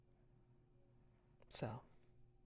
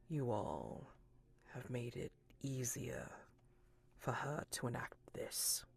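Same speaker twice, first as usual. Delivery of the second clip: soft, half-whispered, measured reproach; man in the sun mask; 6 dB quieter than usual. Acted. Man in the sun mask blames the guests, hushed. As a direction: let the words fall quietly, nearly whispering.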